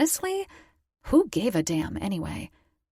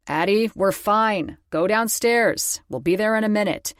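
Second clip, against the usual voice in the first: louder, firm call upstairs; father shutting it down firmly; stern dad calling to his daughter; 3 semitones higher, 6 dB louder than usual. A narrator's reading. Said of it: "voice stern".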